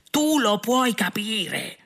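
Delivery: high, drawn-out incredulous cry; escalating outraged yell. A narrator's reading. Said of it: "urlò Arthur". (urlo indignato, acuto e strascicato)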